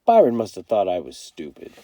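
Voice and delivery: usual speaking voice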